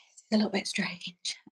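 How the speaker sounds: hushed, breathy inner voice; whispered thought. Retold or imitated. Mother at the scan, thinking in a whisper. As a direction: whisper it to yourself, uneasy.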